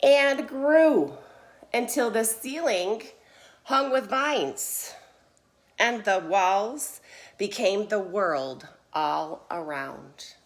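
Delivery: emphatic slow storytelling with long pauses; expressive, drawn-out read-aloud; picture-book narration. A narrator's reading. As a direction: read it like a storyteller, swelling on each phrase. Reads picture book to children.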